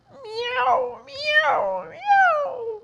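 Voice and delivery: very high mewing cries; kitten meows. Acted. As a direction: mew like a sighing kitten. Kittens sighing meow.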